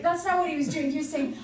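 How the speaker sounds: normal talking voice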